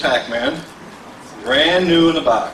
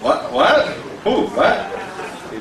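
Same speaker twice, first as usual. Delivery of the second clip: pitched-up, jokey offended "what?"; mock indignant what; 10 semitones higher, much slower than usual. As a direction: pretend to be affronted by the laughter. Audience laughs at his item.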